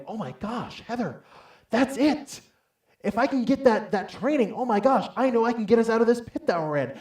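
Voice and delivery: high, breathless burst of excitement; rushing, high-pitched enthusiasm; excited plea. Retold or imitated. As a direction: gush it like you just found the answer. Retells his excited plea to wife.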